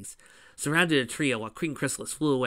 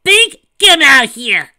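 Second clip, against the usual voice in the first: higher and much louder shout; calling out loud; 12 semitones higher, 19 dB louder than usual. Acted. Amy calling to Big across the fight, loud and high. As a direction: call across the battlefield. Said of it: "Amy call".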